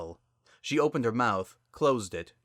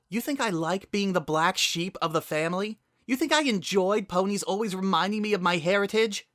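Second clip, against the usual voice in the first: higher, louder indignant outcry; hurt anger; 11 semitones higher, 4 dB louder than usual. Acted. Sunset snapping back at her sister, a higher, louder hurt outcry.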